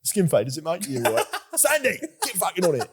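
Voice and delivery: lightly put-on cockney barber voice; a cheeky cockney geezer; cockney dog. Voicing the barbershop dog in a mock cockney accent. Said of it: "Don't know why the dog's a cockney".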